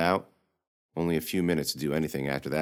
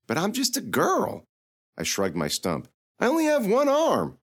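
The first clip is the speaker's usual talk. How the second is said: voiced as a high, earnest young girl; Lily pleading she is only a girl; 4 dB louder than usual. Girl Lily to the mentor, high and earnest.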